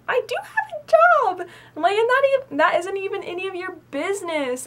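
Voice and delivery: squeaky high-pitched comeback, loud; high indignant retort. Retold or imitated. Teen snapping back at stranger, in a squeaky high indignant voice. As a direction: protest way up high, offended.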